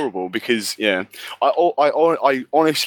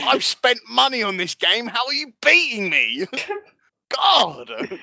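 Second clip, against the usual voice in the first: high-pitched, indignant sore-loser whine; exasperated outburst; 10 semitones higher, slower than usual. Acted. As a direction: protest in disbelief at losing. Mock outburst of a losing player.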